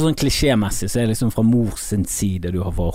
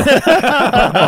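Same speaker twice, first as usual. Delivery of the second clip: sudden shrieking laugh, much higher; high burst of laughter; 6 dB louder than usual. Real, not acted. (høy, plutselig latter)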